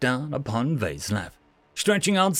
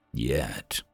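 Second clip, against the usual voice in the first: much lower, soft, icy word; stone-cold; 7 dB quieter than usual. Acted. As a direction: drop it flat and cold. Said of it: "with a stone-cold demeanor".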